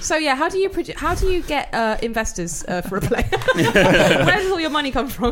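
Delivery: high, loud, hammed-up airhead; ditzy caller. Prank caller quizzes theatre about investors, mock ditzy voice. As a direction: put on a high ditzy voice to pester.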